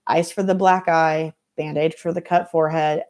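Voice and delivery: usual speaking voice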